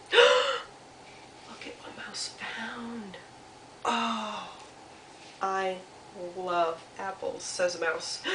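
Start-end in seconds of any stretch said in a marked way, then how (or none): 0.1-3.2 slow, breathy whisper after a gasp; awed whisper. Acted. Storyteller shows children what Mouse found, awed whisper. 3.8-7.4 slow, soft delight, with pauses between words; lightly voiced Mouse savoring each word; contented little Mouse. Mouse admires an apple, soft and contented.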